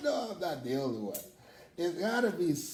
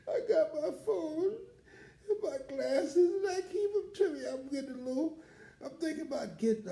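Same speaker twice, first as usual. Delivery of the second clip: laughing tearfully; 13 semitones higher, faster than usual. Real, not acted. Laughing about his forgetfulness.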